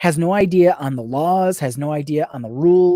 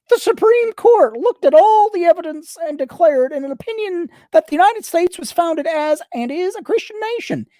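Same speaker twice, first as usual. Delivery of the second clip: high whiny nasal politician voice; Louis Gohmert impression; 12 semitones higher than usual. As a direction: whine it high through the nose. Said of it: "that is the accent I'm going to use".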